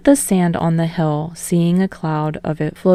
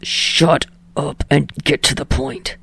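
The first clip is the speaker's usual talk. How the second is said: snarled; 5 semitones lower than usual. Acted. Bakugo answering Deku's call, low snarl.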